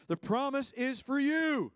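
high, loud, drawn-out declaration; emphatic proclamation. Real, not acted. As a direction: proclaim it straight at the congregation. Drives the promise home.

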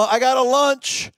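put-on high-pitched eager child voice; little boy. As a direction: pipe up like a small boy. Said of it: "A little boy had a".

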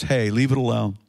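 low, restrained intervention; Jesus as advocate. Acted. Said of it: "the voice of Jesus, our advocate, says".

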